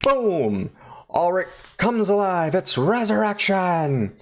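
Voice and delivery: high, drawn-out showy proclamation; mock triumphant. Narrator heralds the drowned man coming alive, high mock triumph. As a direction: herald it like a big reveal. Acting out the big revival moment.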